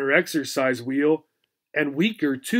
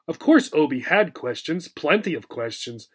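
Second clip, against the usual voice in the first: usual speaking voice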